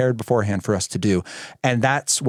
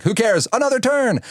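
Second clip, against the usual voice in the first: put-on high, cocky kid voice going up; a little brother bully grabbing his turn; cheeky four-year-old; 11 semitones higher than usual. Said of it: "Then he forgot and was like".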